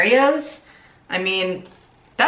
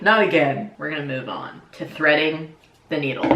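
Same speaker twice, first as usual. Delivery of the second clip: usual speaking voice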